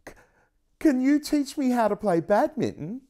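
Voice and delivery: high, hesitant stammering dragon; timid dragon voice. Acted. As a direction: ask shyly, stumbling at the start. Shy dragon asks Noah.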